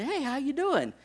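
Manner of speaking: bright high friendly hello; dad greeting lady in line, high and friendly; polite greeting. Retold or imitated. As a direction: greet a stranger sweetly.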